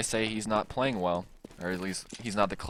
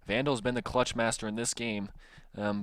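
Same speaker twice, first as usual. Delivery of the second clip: ordinary delivery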